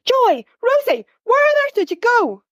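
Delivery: mother bursting in after searching everywhere; frantic angry mum. A narrator's reading. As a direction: shout at the runaway children. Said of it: "She looks pretty mad".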